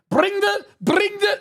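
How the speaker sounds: high rolled-R shout on "Bring the"; mock Briggs callout. Streamer mocks his guild's shot caller, rolling the R in "Bring". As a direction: bark it like an over-excited Chris Farley. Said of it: "he rolls, roll his R's like Chris Farley".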